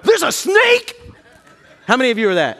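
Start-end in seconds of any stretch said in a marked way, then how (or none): 0.0-1.0 high, rising mock alarm; mock startled. Pastor acting a snake-runner spotting it, mock startled rise. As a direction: gasp it out as a startled question.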